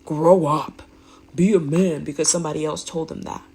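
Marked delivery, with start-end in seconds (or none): 0.1-2.0 harsh father. Retold or imitated. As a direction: bark it like a stern father. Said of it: "those evil men that say".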